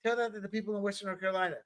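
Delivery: fast, flat catchphrase; quick pointed retort; fast fallback line. Real, not acted. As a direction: rattle it off quickly. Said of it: "That's always my fallback now".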